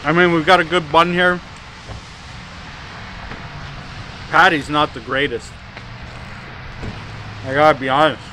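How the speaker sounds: talking between bites of burger; speaking while eating; mouth full, chewing. Real, not acted. Seen 3: Chews throughout, takes new bites at 5.9–6.4 while talking.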